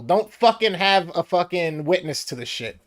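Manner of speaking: ordinary talk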